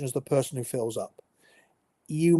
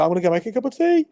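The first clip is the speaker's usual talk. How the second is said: quick, high, over-casual excuse; casual cover-up; 6 semitones higher, 4 dB louder, much faster than usual. Acted. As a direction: blurt a breezy excuse to slip away unnoticed. Covering embarrassment at filling up.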